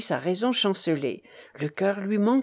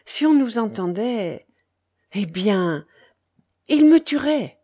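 mounting fear, louder on the last words; trembling; 3 semitones higher, 6 dB louder than usual. A narrator's reading. Rochaine fears being overheard by her would-be killer, trembling. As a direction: build to a frightened burst. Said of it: "dit Rochaine tremblante".

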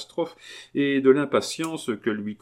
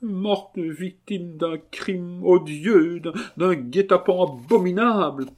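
anguished denunciation; 9 semitones higher, 4 dB louder than usual. Acted. Marquise denouncing the crime, high and tragic. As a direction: draw out the horror melodramatically.

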